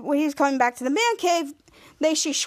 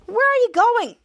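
high, louder mock demand; retold calling out; 8 semitones higher, 5 dB louder than usual. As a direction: imitate calling after someone, pitched up. Calling after a departing character.

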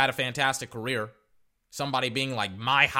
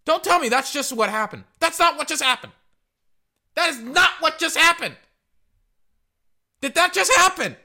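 much higher and louder, near shouting; outraged disbelief; 8 dB louder than usual. Real, not acted. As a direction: protest the call in disbelief. Refusing to believe the call.

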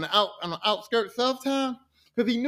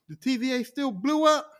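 ordinary delivery, nothing marked

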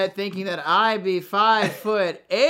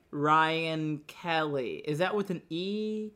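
slow, much softer, careful spelling voice; mock quiet receptionist; 4 semitones lower, 10 dB quieter than usual. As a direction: imitate someone double-checking the spelling, hushed and drawn out. Friend checking spelling, quoted.

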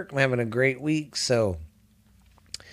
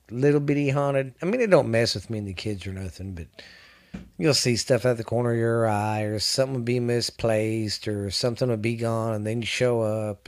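normal talking voice

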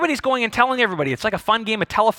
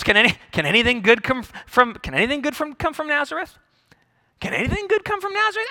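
pitched-up, scornful disbelief; snide twit; 5 semitones higher, faster than usual. Acted. Nathanael scoffing at Nazareth, snide twit. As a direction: play a twit sneering at a town. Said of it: "he's a bit of a twit".

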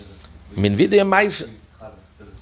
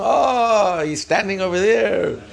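high-pitched mock gossip's exclamation; gossiping onlookers; 8 semitones higher than usual. Gossipers spotting a sinner at the offering, high mock scandal. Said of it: "All the gossipers, everyone".

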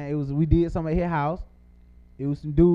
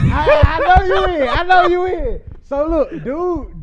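excited; 12 semitones higher, 8 dB louder, slower than usual. Real, not acted. Excited retelling, high and loud.

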